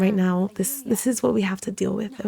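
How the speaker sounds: usual speaking voice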